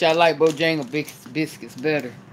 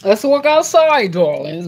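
put-on higher, louder, slow posh accent; 3 semitones higher, 5 dB louder than usual. Playful posh accent inviting viewers outside. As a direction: drawl it out in a mock posh accent. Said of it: "Y'all hear my little accent?".

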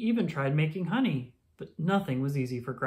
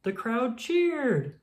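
ordinary delivery

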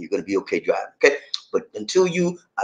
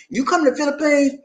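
ordinary talk, nothing marked

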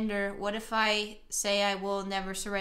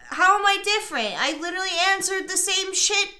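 higher-pitched, exasperated arguing; indignant protest; 6 semitones higher, 4 dB louder than usual. Real, not acted. Player insisting to the game partner nothing changed, indignant. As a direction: argue back, voice pitched up. Arguing back at the game's accusation.

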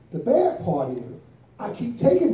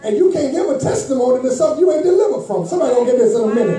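loud, driving preacher's cadence, punching each "still"; full-force preaching; 4 semitones higher, 6 dB louder than usual. Acted. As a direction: preach it hard, building to a shout with the music.

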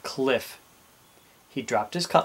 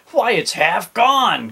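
loud, slow, high outcry; dismayed cry; 8 semitones higher, 8 dB louder, slower than usual. A narrator's reading. Captain sees his nibbled cutlass, aghast cry. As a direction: cry out aghast, stretching the words. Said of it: "cried the captain".

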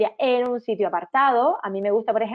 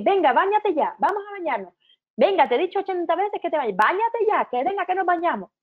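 higher, exasperated parent nagging; impatient nagging; 8 semitones higher than usual. Acted. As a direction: push the child to bathe, fed up. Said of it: "en vez de decir".

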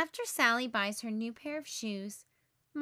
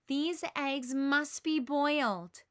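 firm, slow insistence; 2 semitones higher, 3 dB louder, slower than usual. Acted. Patty insists on the plan.

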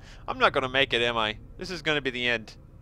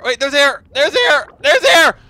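yelling with relief, higher each time; gasping out the good news, getting louder; excited shout; 9 semitones higher, 12 dB louder than usual. Real, not acted. Drowning, finds air, yells.

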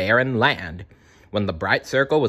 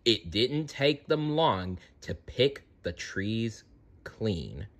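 ordinary delivery, nothing marked

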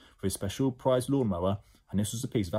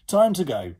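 loud, raised command; giving an order; 8 semitones higher, 8 dB louder than usual. Acted. Ned orders jelly babies out, commanding. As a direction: call out the order briskly to a troop. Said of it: "ordered Ned".

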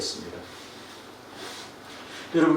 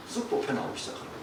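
fast, breathless hushed repeat; quick whisper; 2 semitones lower, 9 dB quieter, faster than usual. Retold or imitated. Preacher telling how the wire suddenly slid out, in a fast whisper.

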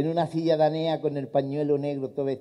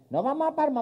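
high put-on old man; 10 semitones higher than usual. Grandpa tells grandma they're going to Palma, high. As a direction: grandpa correcting insistently.

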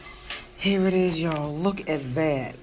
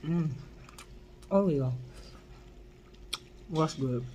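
eating meatball; 7 dB quieter than usual. Real, not acted. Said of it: "We're gonna bite a meatball".

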